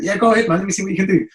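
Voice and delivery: high, loud and game; eager invitation. Acted. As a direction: egg him on, pitched right up. Acted reply eagerly inviting a try.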